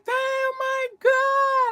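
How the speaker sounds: high, loud, stretched-out cry; amazed exclamation. Retold or imitated. Manager rejoices to Vanessa about the remedy, amazed cry. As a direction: imitate someone exclaiming in amazement.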